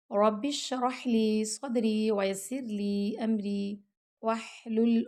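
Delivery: slow, measured prayer recitation; recited dua. Acted. Teacher opening a Quran class with a slow dua. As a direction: open the lesson with a quiet, slow supplication.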